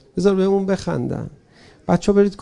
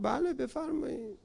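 quiet dismissal; 7 semitones higher, 13 dB quieter than usual. Acted. Imam dismisses deserters softly.

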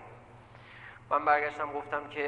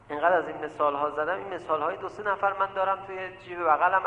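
ordinary talk, nothing marked

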